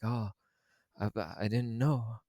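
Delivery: a lightly voiced, put-on dad making excuses; a hint of the lying dad's voice; imitating the dad. Reviewer mocking the dad caught out by his kids, lightly imitated. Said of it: "that's not his accent".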